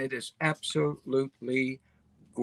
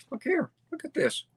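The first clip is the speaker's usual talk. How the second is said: jumps much higher, bright exclaim; excited look; 11 semitones higher than usual. Real, not acted. Showing viewers the Rambla boardwalk, excited high exclaim. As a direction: burst up high with delight at the picture.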